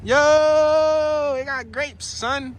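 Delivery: high, excited squeal at the grapes; giddy high-pitched wow; amazed exclamation. Real, not acted. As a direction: marvel at it, pitch way up.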